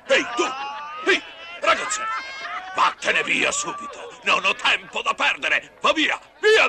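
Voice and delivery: high angry shout to go away. Acted. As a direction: shout him off angrily. Chasing off boy angrily.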